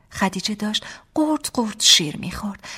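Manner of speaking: ordinary delivery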